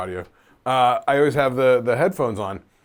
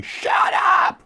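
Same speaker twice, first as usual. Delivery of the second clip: high-pitched strained shout; acted outburst of inner exasperation; yelling. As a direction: scream it like you've snapped. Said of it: "my voice in my head yelling".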